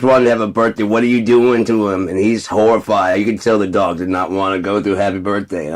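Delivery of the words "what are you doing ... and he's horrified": ordinary delivery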